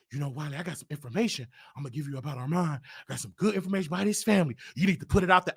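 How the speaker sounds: low, fast, hushed gossip voice; a sly low murmur, rushing the dirt out; imitating Tasha. Imitating Tasha passing him dirt, low and hushed.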